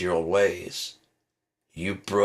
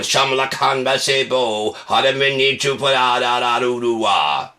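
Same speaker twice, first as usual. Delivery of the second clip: loud, higher gibberish preacher; mock tongues preacher; 4 semitones higher, 6 dB louder than usual. Comic mimics televangelist speaking in tongues, loud and high. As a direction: rebuke like a frenzied preacher. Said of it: "he's speaking in tongues".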